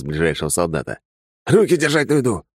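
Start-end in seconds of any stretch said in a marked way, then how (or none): 1.5-2.4 high, harsh shouted command through a mask; masked soldier order. Acted. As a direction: bark the order like a masked trooper.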